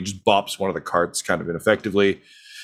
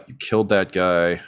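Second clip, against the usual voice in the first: usual speaking voice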